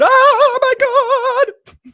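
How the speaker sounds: high, loud oh my God; shocked gasp. Real, not acted. Spotting something in the trailer, exclaiming loud and high. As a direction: exclaim in disbelief.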